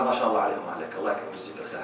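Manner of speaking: a husband thanking his wife softly instead of scolding; gentle praise. Retold or imitated. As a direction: soothe her with quiet praise. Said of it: "قول لها".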